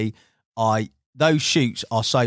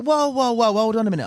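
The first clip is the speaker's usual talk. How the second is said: alarmed whoa; 9 semitones higher than usual. Retold or imitated. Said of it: "And I was like".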